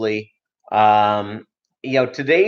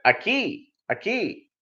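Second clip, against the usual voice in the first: high, soft, gentle repeat; soft patient Spanish; 9 semitones higher, 7 dB quieter than usual. Retold or imitated. Customer answering the cashier softly in Spanish. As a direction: say it sweetly.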